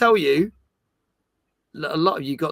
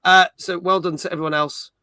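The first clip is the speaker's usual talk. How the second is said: usual speaking voice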